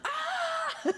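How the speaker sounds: high squeal breaking into laughter; joyful scream with a laugh. Retold or imitated. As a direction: shriek with delight at the scoreboard. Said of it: "I was like".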